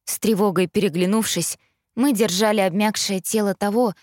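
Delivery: plain reading voice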